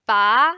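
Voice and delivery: "ba" rising, loud; second tone. Shown as a demonstration. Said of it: "Let's try again".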